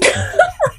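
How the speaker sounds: very high, loud shriek of laughter. Real, not acted. Laughing at the Dilma story.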